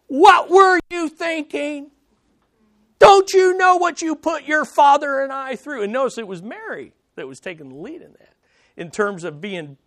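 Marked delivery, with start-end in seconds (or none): 0.1-5.8 exasperated parent scolding a runaway son; scolding mother. Acted. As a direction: tear into him like a frantic mother. Mary scolding the boy Jesus.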